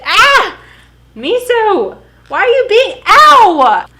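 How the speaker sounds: high, loud cries of pain at the dog; startled yelling. Real, not acted. Owner yells at her jumping puppy, high and loud. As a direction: yelp and scold at once, caught off guard.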